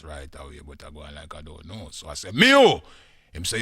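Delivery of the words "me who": high, loud challenge to a caller; feigned-ignorance shout. Acted. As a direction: shout it as if you don't know.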